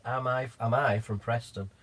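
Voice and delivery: ordinary talk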